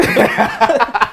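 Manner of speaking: loud high laugh breaking out; burst of laughter. Real, not acted. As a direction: laugh out loud at your own joke. Laughs at own advice.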